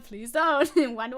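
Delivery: ordinary talk, nothing marked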